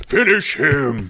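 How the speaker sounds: Mortal Kombat announcer. Retold or imitated. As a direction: growl it like the game voice. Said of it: "old school".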